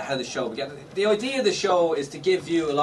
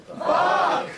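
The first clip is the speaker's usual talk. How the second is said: huge, high shout; shouted swear; 5 dB louder than usual. Real, not acted. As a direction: yell it with the crowd. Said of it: "on the count of three".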